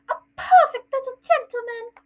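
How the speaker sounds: high, loud doting falsetto; Aunt Petunia. Acted. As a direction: gush over a precious little boy. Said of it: "it's Aunt Petunia".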